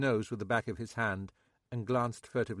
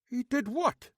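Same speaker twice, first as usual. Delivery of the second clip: agitated; 14 semitones higher, 4 dB louder than usual. A narrator's reading. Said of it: "clearly agitated".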